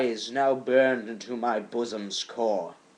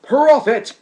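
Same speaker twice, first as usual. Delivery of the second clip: much higher and louder, pleading; impassioned cry; 12 semitones higher, 12 dB louder than usual. Acted. Poet crying out to the raven, high and loud. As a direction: call the bird out in desperation. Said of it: "said I".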